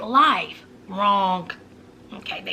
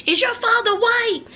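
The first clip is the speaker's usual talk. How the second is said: a high, prying gasp of a question; nosy high question; 11 semitones higher than usual. Retold or imitated. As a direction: pitch shoots up on a prying question.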